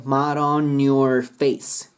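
slow "mud on your face", N running into "your"; linked N. Shown as a demonstration. Teacher demonstrating the N link, slow and joined. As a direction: say "on your" as "on-nyor", stretched. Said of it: "ここのNとくっついて".

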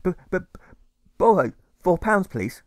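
high, halting stammer on the b; a shy boy stuttering out his plea. A narrator's reading. Alfred stammers as he begs his friend for money.

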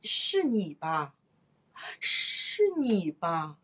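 breath in, then slow, drawn-out 是你吧; as if wanting to speak and stopping; hesitant, holding back. Shown as a demonstration. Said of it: "给人一种欲言又止的感觉".

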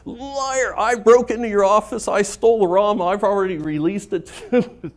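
high, loud gleeful yelling; shouting accusation. Retold or imitated. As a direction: yell it out, triumphant and laughing.

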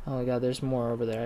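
ordinary talk, nothing marked

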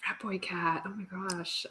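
usual speaking voice

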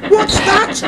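very high-pitched startled engine; high, alarmed character question; startled gasp. A narrator's reading. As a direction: gasp it as a surprised little engine. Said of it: "he gasped".

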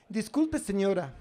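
a hint of a meek polite lad. Young man voiced politely. (el chico ecuatoriano, educado y agudo)

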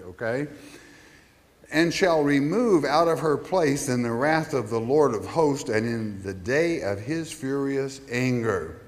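normal talking voice

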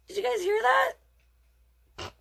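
very high, slow mock-astonished; amused disbelief. Real, not acted. Reader laughing at his own tutu stumble, mock astonished. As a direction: stretch it out in comic disbelief.

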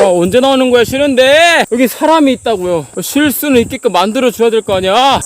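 loud, high-pitched griping; exasperated shouting. Real, not acted. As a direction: yell your complaint at the endless road. Complains loudly about endless climb.

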